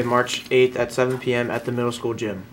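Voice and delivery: usual speaking voice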